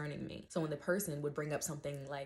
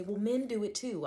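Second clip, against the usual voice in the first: higher and louder whiny retort; mocking commenter; 5 semitones higher, 6 dB louder than usual. Mocking a commenter who deflects, in a whiny voice. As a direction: sneer it back like a defensive troll.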